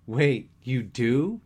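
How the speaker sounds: loud, high, drawn-out double take; amazed. A narrator's reading. Dan learning his friend talks dirty, loudly amazed.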